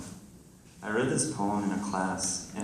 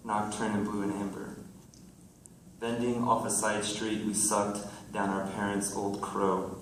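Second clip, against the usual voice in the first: projected, deliberate verse delivery; poem reading; 5 dB louder than usual. Acted. Poet reading to friends in the audience, projected recital. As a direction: recite it to the room in measured phrases.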